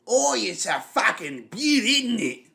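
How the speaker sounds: loud, high, hammed-up Australian crowing; Kano. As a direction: belt out a broad mock Aussie accent, drawn out. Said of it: "he's super fucking Australian".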